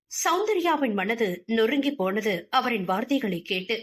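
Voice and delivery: ordinary delivery, nothing marked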